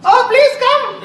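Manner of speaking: squealed way up high and loud; shrill-free high welcome, pitched far up. Shown as a demonstration. Teacher demos a very high-pitched welcome. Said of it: "If somebody says the pitch is very high".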